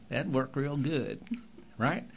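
lightly voiced Texan, sound placed in the throat; Texas accent. Shown as a demonstration. Coach demonstrating a light Texas accent. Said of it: "they could be down in Texas and doing that".

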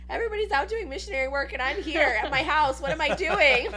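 mock panic.

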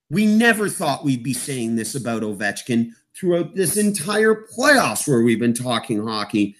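normal talking voice

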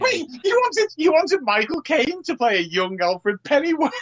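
high-pitched disbelief; real incredulity. Real, not acted. Co-host questioning Michael Caine as young Alfred, incredulous. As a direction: pitch right up, can't believe the suggestion. Disbelief at co-host's casting idea.

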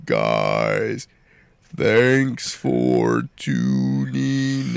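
very low, very slow sign-off; slow ominous raspy drawl. Acted. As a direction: drawl the outro like a creepy character. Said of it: "All right, Hershey Squirts, take us off air".

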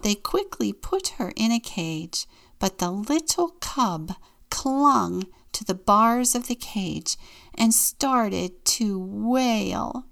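ordinary delivery

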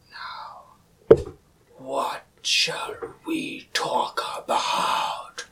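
very slow, breathy whispered menace; slow ghostly villain whisper; creepy whisper. Retold or imitated. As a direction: draw each word out in a sinister whisper. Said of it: "I'm gonna do a face rising one".

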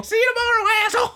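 high squeaky insult; mock farewell. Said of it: "mimicking the woman".

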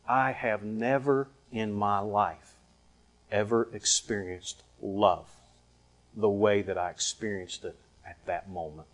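quiet, measured awe, fading at the end; hushed confession. Retold or imitated. Quoting her confession of love.

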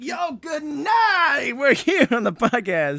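high, slow shouted hype greeting; excited greeting. Acted. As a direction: greet the crowd all pumped up. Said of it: "you're like".

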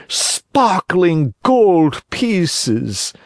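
high, slow, honeyed fox voice; sly fox. Acted. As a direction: spin the tale to lure the puppet. Fox counts slowly.

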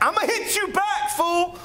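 squeaky high mock threat with a laugh. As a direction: pitch way up, playful threat.

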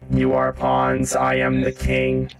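slow, grand evil monologue; gloating villain. Acted. The villain reveals to the party that he rules them, slow and grand. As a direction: savour every word of power.

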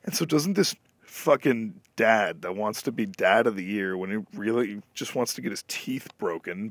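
ordinary talk

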